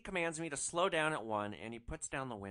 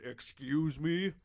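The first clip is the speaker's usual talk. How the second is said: lower mock excuse me; 3 semitones higher, 6 dB louder, much slower than usual. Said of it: "Although she might have said".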